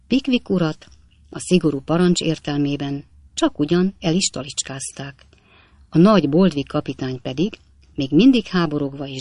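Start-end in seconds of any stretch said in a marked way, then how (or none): none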